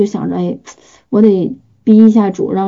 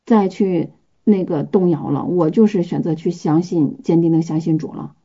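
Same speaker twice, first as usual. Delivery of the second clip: quiet resolve; 4 semitones lower, 7 dB quieter than usual. Retold or imitated. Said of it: "做一个决定".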